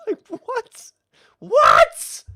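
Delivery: high, loud disbelieving "what?"; incredulous shout. Real, not acted. Incredulous at orc family scene.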